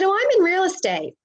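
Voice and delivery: high, perky American tourist; American accent. Retold or imitated. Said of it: "this is my American accent".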